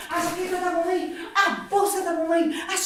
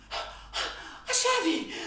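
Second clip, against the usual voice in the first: breathless whispered realization; 5 semitones higher, 7 dB quieter than usual. Acted.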